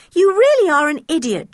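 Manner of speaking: exasperated. Acted. Dick exasperated with George.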